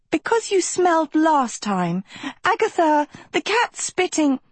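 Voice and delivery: high, agitated protest; rising panic, pitched high; flustered alarm. Acted. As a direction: plead in a high, worried voice. Pleading while cat threatens.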